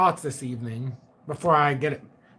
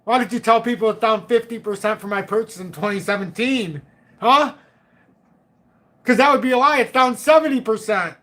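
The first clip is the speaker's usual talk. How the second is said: mock angry viewer; 5 semitones higher, 4 dB louder than usual. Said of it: "people in this audience that are sitting there going".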